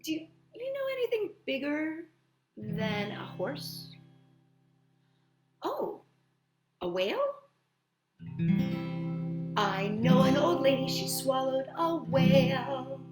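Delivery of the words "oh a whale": plain reading voice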